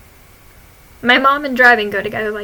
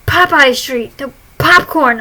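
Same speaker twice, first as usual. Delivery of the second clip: loud mom voice; 3 semitones higher, 9 dB louder, slower than usual. Retold or imitated. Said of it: "and she goes".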